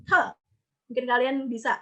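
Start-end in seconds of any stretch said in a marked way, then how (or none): 0.0-0.2 Te with a puff of air after the t; short, sharp Te with air released; aspirated te. Shown as a demonstration. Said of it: "Coba kalian rasain".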